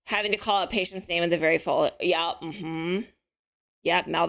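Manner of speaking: usual speaking voice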